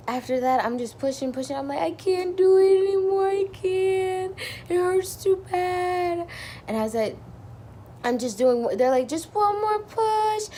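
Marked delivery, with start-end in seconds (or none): 1.8-6.3 high, loud, strained whine that slows to a drag; pained plea. Retold or imitated. Mother in labor begging the nurses to stop, high pained whine. Retold exhausted plea while pushing. 9.1-10.5 high, slow, sing-song encouragement; coaxing nurse. Retold or imitated. Nurse coaching the mother to push, high sing-song coaxing.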